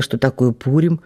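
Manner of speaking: ordinary delivery, nothing marked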